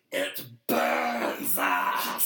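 low, slow, creature rasp; creeping creature hiss. Retold or imitated. McConnell hiding from the press, low slow creature rasp. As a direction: drag it out like something shrinking from light.

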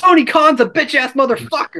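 high, fast joking insult; mock rant. Real, not acted.

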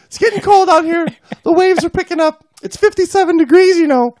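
high falsetto whining complaint; whiny wife voice. Acted. Wife complaining to her husband about the cold, high falsetto whine.

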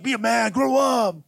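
stern dad. Retold or imitated. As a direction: snap it like an old-school dad.

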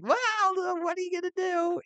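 high-pitched, carefree comic voice; mock-casual, way up high; breezy Weasleys. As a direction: toss it off in a silly high voice.

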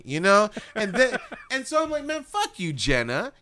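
ordinary delivery

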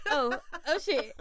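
startled with a laugh; a hint of mock panic; alarmed. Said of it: "I was like".

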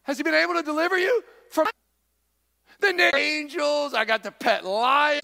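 high, loud teasing shout; cheeky Daniel. Acted. Daniel shouting up to the king from the den, high and teasing. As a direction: taunt the worried king from below. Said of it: "Daniel's down there with a lion like".

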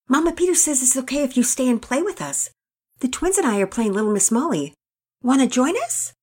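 put-on an eager girl inviting her friend; young Penelope inviting Nora to play. A narrator's reading. Penelope voiced in story.

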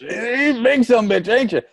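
very high, slow mock voice; roofer catchphrase impression. Co-host teasing with the roofer's line, high mock voice. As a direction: stretch it out in a goofy high voice.

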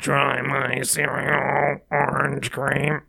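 low, slow buzzing robotic rasp; smoker's electrolarynx voice. Retold or imitated. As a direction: talk like a smoker through a voice box. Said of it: "he sounds like a smoker with one of those module boxes".

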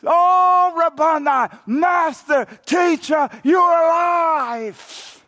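loud, high joyful cry, last words stretched slow; Mary shouting her joy as she recognizes Jesus; excited scream. Acted. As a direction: cry out in sudden joy at seeing him. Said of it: "She screams with grand excitement".

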